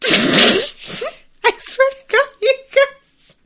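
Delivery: high, loud words squeezed out through laughter; can't get through it for laughing; laughing helplessly. Real, not acted. Recapping the show to her viewers, laughing helplessly. Cracking up at the twist.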